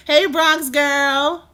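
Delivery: loud, high, long-drawn hello; calling out greeting. Real, not acted. Streamer greets a viewer in the chat, calling out. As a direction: holler it out slowly to a friend.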